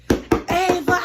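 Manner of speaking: high, loud holler; re-enacted scream for a friend; screaming a name. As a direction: yell the name at a closed door. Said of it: "We screaming her name".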